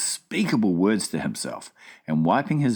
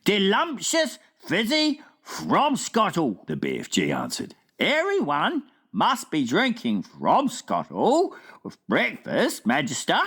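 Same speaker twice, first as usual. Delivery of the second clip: BFG voice; 12 semitones higher than usual. Acted. Giant asking the Queen at breakfast, wondering. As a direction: puzzle it out like a big gentle giant.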